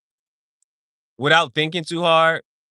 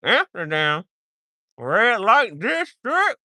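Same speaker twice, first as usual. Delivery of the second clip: hammed-up Dutch, climbing high and slow; silly Dutch pronunciation, drawn out; put-on Dutch accent; 7 semitones higher, slower than usual. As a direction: stretch it out in a mock Dutch accent. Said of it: "I know how to say that".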